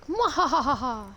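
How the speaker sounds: evil maniacal cackle; mad scientist. Pretend. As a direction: cackle like a madman. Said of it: "running around like a lunatic".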